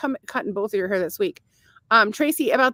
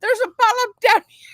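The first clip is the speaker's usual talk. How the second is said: imitating the doctor; 12 semitones higher, 6 dB louder than usual. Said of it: "he said to him".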